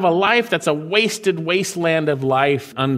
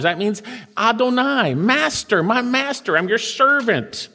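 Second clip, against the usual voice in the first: ordinary talk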